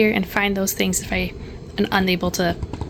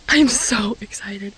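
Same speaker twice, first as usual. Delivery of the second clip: whispered excitement; slower than usual. Real, not acted. Excited about cover.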